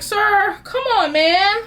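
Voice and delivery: high, fast pleading to God; fast, high exasperated begging; exasperated plea. Retold or imitated. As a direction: hurry him up, half-joking. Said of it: "it just feels like".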